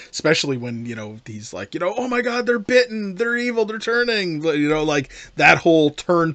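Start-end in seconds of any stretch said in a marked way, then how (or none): 2.0-4.4 high-pitched imitated alarm; mock panic. Host voicing Harry warning about the bitten, mock panic. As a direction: play a panicking villain, raised pitch.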